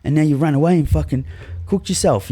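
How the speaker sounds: put-on a little kid scolding a grown-up who left; hurt inner child.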